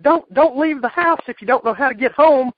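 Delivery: high, loud exasperated impression; Cornette impression. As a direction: squawk it like a cranky manager. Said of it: "He said, he said".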